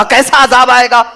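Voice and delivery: defiant tribe taunting the prophet; mocking challenge. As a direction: throw the question back in scorn.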